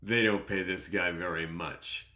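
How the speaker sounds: lightly voiced dismissive husband, slow start; scoffing laugh, then a mock-reassuring put-down; a hint of a condescending rich man; imitating scornful guest. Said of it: "then Mister Jones goes".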